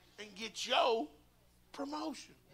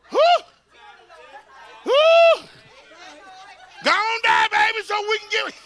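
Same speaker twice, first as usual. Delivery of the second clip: high, loud preaching cry; fervent shout; 13 semitones higher, 17 dB louder than usual. Real, not acted. Preacher calling congregation to die to self, fervent shout. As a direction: urge them on at full voice.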